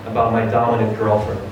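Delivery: usual speaking voice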